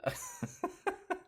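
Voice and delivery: high-pitched, soft burst of laughter; real laugh. Real, not acted. Host amused by his yelling toddler, high soft laughing. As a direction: giggle helplessly, high and quiet. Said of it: "I'm sorry, I'm laughing".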